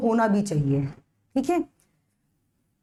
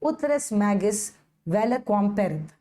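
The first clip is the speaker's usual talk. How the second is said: ut res magis vale quam parent read out very slowly; slow careful reading; much slower than usual. Shown as a demonstration. Teacher reads the Latin rule slowly for students. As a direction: lay out each Latin word slowly for students. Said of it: "rule जिसको कहा जाता है".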